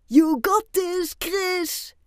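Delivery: high, drawn-out English cheer; father calling out. A narrator's reading. Chris's father cheers his child on, calling out. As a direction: shout slow encouragement over a crowd. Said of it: "roept Chris zijn vader".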